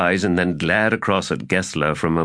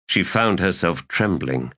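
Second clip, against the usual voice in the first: ordinary delivery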